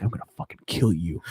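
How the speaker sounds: low, hushed threat; mock threatening. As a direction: imitate a killer menacing someone, low and soft. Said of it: "threatening him".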